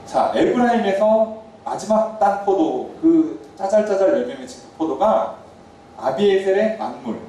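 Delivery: slow, deliberate unpacking of the phrase; slow teaching tone; slow explaining. Shown as a demonstration. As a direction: spell it out slowly for the congregation. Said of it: "explaining the phrase slowly".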